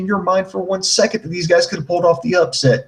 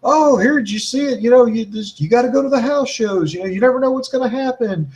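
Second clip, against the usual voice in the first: high, mock hype sales voice; mocking WWE pitch; 6 semitones higher than usual. Host mocking WWE's hype, high sarcastic. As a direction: lampoon the promo pitch, higher. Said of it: "It's like".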